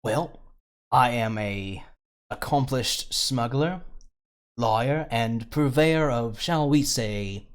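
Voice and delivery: slippery rogue pleased with himself, only lightly put on; cocky smuggler who just broke out of his cell; smuggler NPC.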